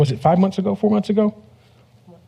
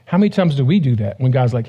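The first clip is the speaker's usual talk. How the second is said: ordinary delivery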